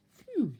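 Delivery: loud relieved phew. Acted. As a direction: blow out the relief. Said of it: "he said".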